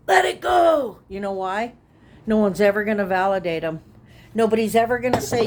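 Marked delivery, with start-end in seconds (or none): 0.1-1.0 high, loud mocking shout of let it go; sudden high shout. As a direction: fling it out like a dismissive brush-off. Mocking what people say.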